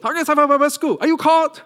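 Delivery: high, loud, indignant questioning; angry parent tone. Retold or imitated. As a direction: demand an answer like a shocked father. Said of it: "That was my tone".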